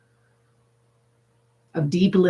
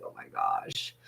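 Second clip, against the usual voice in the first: breathy whispered groan of the heat; whispered exasperation; 12 dB quieter than usual. Pretend. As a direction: sigh it out under your breath, overheated. You're just like, overheated.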